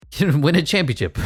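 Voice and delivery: breaks into a real laugh mid-line. Real, not acted. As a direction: giggle through the words. Laughs at his own hope.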